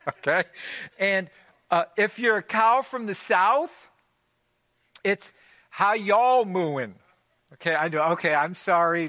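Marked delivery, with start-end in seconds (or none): 2.0-6.9 loud, slow, high Southern drawl for a cow; Southern cow accent. Pretend. Preacher hams up a Southern cow for the congregation, loud drawl. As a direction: stretch it out like a Southern cow, hammed up. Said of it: "That was terrible".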